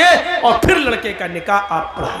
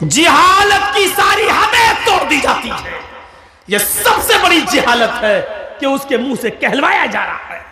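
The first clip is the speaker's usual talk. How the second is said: ordinary talk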